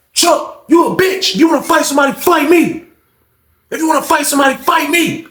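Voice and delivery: high-pitched, worked-up dare; hammed-up angry friend; acted fight challenge.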